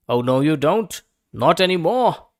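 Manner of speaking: loud, forceful rat refusal; loud declaration. A narrator's reading. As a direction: bark it out like a bully thief. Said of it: "declared the highway thief".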